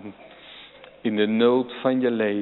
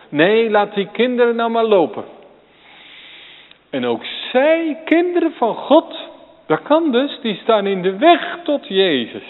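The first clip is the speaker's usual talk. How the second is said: much higher and louder, pleading; fervent high preaching; 8 semitones higher, 5 dB louder than usual. Acted. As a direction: lift the voice to a fervent pitch. Preacher rising in fervour.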